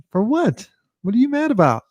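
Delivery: a mock disbelieving, high-pitched "For what?"; baffled question. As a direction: ask it like you can't believe it. Asking the angry person why.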